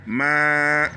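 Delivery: ordinary delivery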